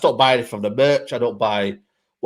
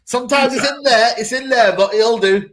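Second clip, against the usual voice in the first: put-on much higher voice of a young girl explaining; little girl voice; 8 semitones higher than usual. A little girl at the stadium explains her trick, high childlike voice. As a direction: play a seven-year-old proudly sharing a trick. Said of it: "she's about seven years old".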